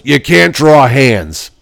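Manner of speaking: slow, flat verdict from a stern dad; blunt dad verdict. Retold or imitated. Imitating the dad dismissing his drawings, slow and blunt. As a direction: lay it down slowly like a curt critic.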